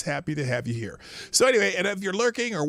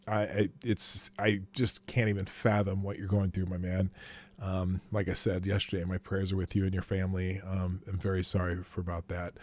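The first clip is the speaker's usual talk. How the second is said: low, subdued and heartfelt; sombre condolence; 7 semitones lower, 3 dB quieter than usual. Real, not acted. Host consoling a friend who lost a baby, low and sombre. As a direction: offer sympathy quietly, in a low voice.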